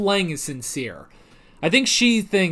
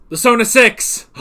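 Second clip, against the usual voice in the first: excited exclamation; 6 semitones higher, 7 dB louder than usual. Real, not acted. Excited reveal of group name.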